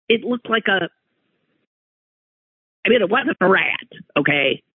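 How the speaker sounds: usual speaking voice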